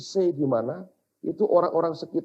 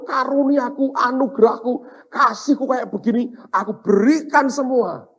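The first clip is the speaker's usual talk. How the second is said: high, loud, emphatic sermon delivery; impassioned preaching; 10 semitones higher, 9 dB louder than usual. Real, not acted. Preacher recounts his dream to the congregation, impassioned. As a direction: preach it with raised, insistent force.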